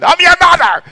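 high, loud, hammed-up mother yell; mock mother shouting at her grown kid; mock angry mother. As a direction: bark it like an outraged mom. Parent yelling at grown child.

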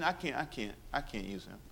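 hushed heavenly regret; soft sorrowful God. Retold or imitated. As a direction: grieve it quietly, almost to yourself. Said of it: "God has a meeting in heaven saying".

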